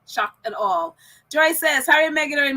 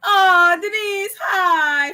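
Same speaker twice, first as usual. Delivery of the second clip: drawn-out, louder hello to a fan; excited greeting; 4 semitones higher, 6 dB louder, much slower than usual. Real, not acted. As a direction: call out to her happily. Greeting a listener by name.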